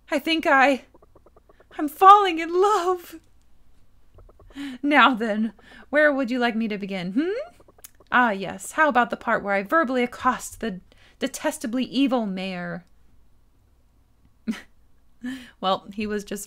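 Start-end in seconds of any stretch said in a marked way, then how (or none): 0.1-3.2 high, loud swooning; hammed-up, overcome with love; smitten declaration. Jasper overcome by his audience. 4.8-12.9 lightly voiced grandiose raconteur; resume the tale with relish; a hint of theatrical self-importance; pompous storyteller. Jasper resumes his tale.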